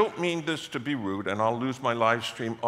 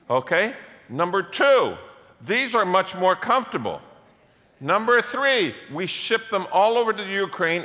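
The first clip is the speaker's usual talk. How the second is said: louder and higher, driving sermon delivery; preaching at full force; 6 semitones higher, 7 dB louder than usual. Acted. Pastor firing up his congregation, loud preaching. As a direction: build it up and preach it out to the room.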